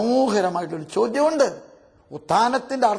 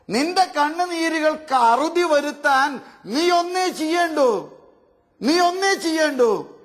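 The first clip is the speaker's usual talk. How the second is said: fervent appeal; 7 semitones higher than usual. Real, not acted. Preaching climax appeal.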